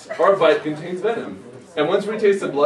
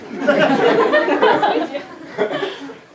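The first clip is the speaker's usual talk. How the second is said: high giggle fit; cracking up; squealing laugh. Real, not acted. Laughs after line.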